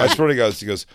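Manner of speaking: ordinary delivery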